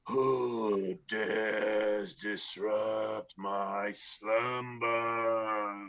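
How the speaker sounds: slow, booming mummy voice. Acted. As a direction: boom it out like a waking mummy. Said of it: "you hear in a booming voice".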